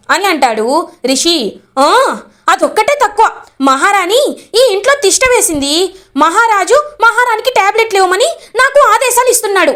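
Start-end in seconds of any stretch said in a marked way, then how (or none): 1.8-9.7 higher, sharp annoyed complaint, faster at the end; narrated maid grumbling at her cousin, pitched higher and annoyed; irritated grumbling. A narrator's reading. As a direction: huff at being ordered around. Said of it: "ఎందుకలా విసుక్కుంటావు".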